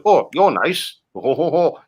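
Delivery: normal talking voice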